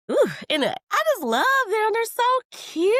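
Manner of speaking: high, loud fangirl squeal; excited gushing. Real, not acted. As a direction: squeal over how cute they are. Gushing about the couple.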